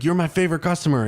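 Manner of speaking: high, quick, beaming driver voice; imitating the driver. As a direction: rattle it off like a delighted driver. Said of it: "He's like".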